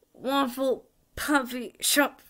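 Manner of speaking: slow, high-pitched muffled garble; muffled inside a hat. Acted. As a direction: mumble from inside a jammed-on hat. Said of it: "voice from inside the hat".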